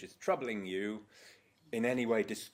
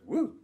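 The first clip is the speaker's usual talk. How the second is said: high, louder imitating woo; mimicked woo; 12 semitones higher, 4 dB louder than usual. Mirroring the man in the coma with a high woo. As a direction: echo the coma patient's woo back. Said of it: "Arnold Mindell lies down and goes".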